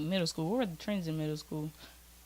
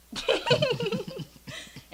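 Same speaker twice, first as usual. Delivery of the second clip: a high, squealing burst of laughter; 3 dB louder than usual. Real, not acted. Recalling an embarrassing phone photo, squealing laughter.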